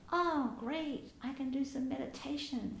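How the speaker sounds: sarcastic cheer at three a.m; mock eager self. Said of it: "you go".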